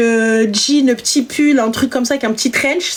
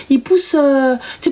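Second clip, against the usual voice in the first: ordinary delivery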